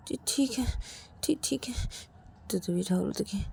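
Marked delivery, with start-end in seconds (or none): 0.1-1.9 soft, high, breath-catching stammer; weak, pained reply. Acted. Ravi answers worried Rahul, weak and stammering. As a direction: squeeze it out faintly.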